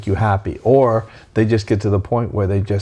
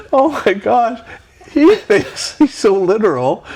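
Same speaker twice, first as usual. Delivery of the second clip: laughing retelling; 12 semitones higher, 4 dB louder, slower than usual. Retold or imitated. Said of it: "we start laughing".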